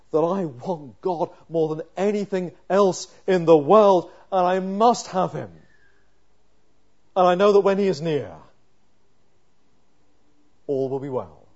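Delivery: loud, urgent sermon voice; pleading with God on the psalmist's behalf; fervent preaching. Acted. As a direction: preach it out with full force. Climactic preaching.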